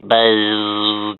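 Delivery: low buzz. Acted. Animal sounds game.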